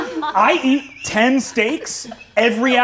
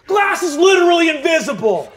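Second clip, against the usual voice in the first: emphatic shout; 7 semitones higher, 4 dB louder than usual. Acted.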